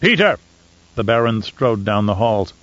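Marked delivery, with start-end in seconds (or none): 0.0-0.4 calling out. Acted. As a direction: summon him across the halls. Baron calls for his Mentat.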